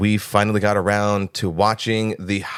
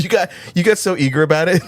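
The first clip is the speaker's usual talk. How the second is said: laughing; 9 semitones higher, faster than usual. Real, not acted. Laughing at cohost.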